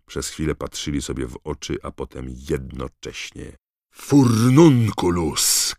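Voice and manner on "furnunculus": loud, high, drawn-out incantation; shouted spell. A narrator's reading. Harry fires a curse at Malfoy, shouted spell. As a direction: shout the spell slowly and forcefully. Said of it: "krzyknął Harry".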